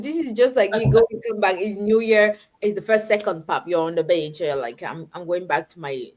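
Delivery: ordinary delivery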